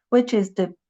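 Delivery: ordinary delivery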